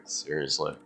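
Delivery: ordinary delivery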